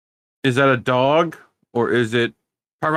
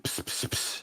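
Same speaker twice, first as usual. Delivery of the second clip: whispered psst psst hiss; imitating psst sound; 15 dB quieter than usual. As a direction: hiss it like calling someone. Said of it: "who the fuck said".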